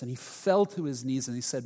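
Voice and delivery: ordinary talk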